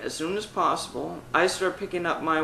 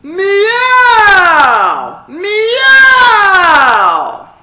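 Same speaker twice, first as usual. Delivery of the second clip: high, loud meow held long; belted cat meow; 17 dB louder than usual. Shown as a demonstration. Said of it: "hold up the mirror".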